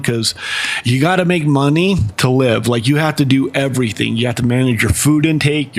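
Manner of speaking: ordinary delivery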